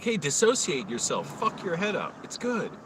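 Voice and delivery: mock airy guru. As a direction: sell the nonsense with a climbing chirpy pitch. Mocking meditation advice.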